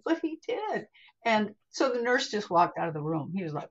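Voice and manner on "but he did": high-pitched innocent protest; naive insistence. Retold or imitated. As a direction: repeat it guilelessly, voice pitched way up. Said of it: "I was just so naive".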